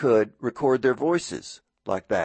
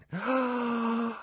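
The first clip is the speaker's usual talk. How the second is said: excited gasp; 11 semitones higher, 6 dB quieter than usual. Retold or imitated. Kid thrilled by cassette recorder.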